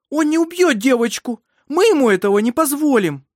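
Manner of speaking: high, loud, impassioned shout; defiant cry. A narrator's reading. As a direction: vow fiercely to protect the baby. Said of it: "Карин вскрикнула".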